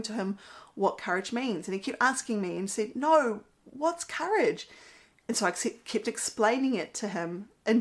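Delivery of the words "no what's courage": imitating an upset child, higher and slow; a touch of frustrated little boy. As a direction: mimic a toddler insisting. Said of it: "he was getting so upset".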